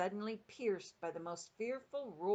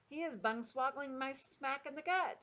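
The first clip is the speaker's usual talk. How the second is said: high mock shout of protest; gizzard gulper; 7 semitones higher than usual. A narrator's reading. Giant kicked awake, acted shout of outrage. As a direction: complain high, without full volume. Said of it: "shouted the gizzard gulper".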